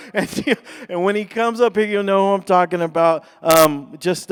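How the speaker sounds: bursting with laughter at the T-shirt joke; real laughter spilling into loud words; excited laughing. Real, not acted.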